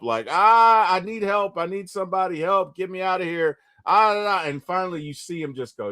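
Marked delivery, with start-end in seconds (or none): 0.3-4.5 higher, mock cry for help, loud at the start; a retold half-hearted yell for help; half scream for help. Peter trapped under concrete, mild mock yell for help. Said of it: "he halfway screams for help".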